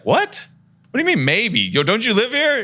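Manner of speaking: high disbelieving outburst; high stunned questioning of the tenant; incredulous what. Retold or imitated. Shocked reaction to tenant.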